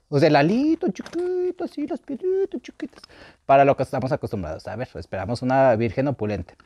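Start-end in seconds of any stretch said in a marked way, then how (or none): 0.3-3.0 much higher, slower mocking diminutive voice; mock tiny voice. Mocking the drag queen's skimpy costume, in a much higher, slower squeak. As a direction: belittle the costume in a squeaky high voice.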